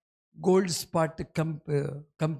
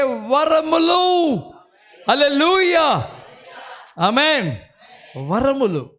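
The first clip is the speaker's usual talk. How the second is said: louder and higher preacher's climax; preaching at full force; 5 semitones higher, 6 dB louder, slower than usual. Acted. Preacher listing the gifts to the congregation, building to a shout.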